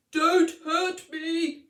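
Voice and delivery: a big lion begging like a coward; lion's whimper. Acted. Scared lion backing away from the mouse, slow high whimper. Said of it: "he whimpered".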